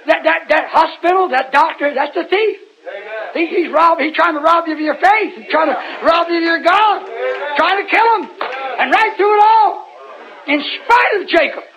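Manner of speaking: high, rising, fervent preacher's cry; preaching. Real, not acted. Preacher urging the congregation that God keeps him alive, fervent preaching. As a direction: build the sermon with high, driving emphasis.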